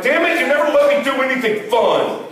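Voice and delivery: mock-sulking husband; whiny complaint. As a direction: whine like a kid denied a toy. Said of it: "And I'm like".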